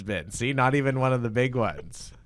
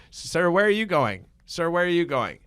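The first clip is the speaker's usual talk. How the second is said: calling out; 8 semitones higher, 6 dB louder than usual. Acted. Calling after someone in the scene.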